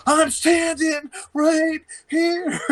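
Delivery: very high, slow, drawn-out shout; hammed-up outraged yell; angry shout. Angry friend retold.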